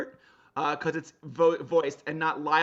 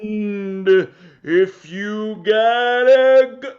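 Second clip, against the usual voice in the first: slow sloth voice; 3 semitones higher, 10 dB louder, much slower than usual. Acted.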